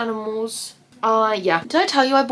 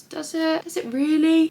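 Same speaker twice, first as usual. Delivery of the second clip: higher, mock-questioning doubt; sarcastic doubt; 7 semitones higher than usual. Real, not acted. Doubting her own contour.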